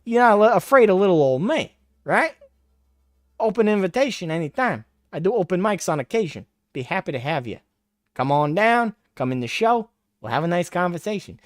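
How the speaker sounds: high sing-song taunting invitation; goading a critic onto the show, mocking. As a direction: needle him with a high mocking voice.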